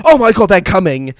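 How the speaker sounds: high, loud panicked cry; hammed-up alarm at an attack; mock panic. French soldiers spotting the Germans, mock panicked shout.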